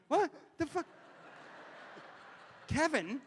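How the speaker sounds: high, incredulous; stunned by the wrong name; baffled. Retold or imitated. Called [name] by [name], incredulous high.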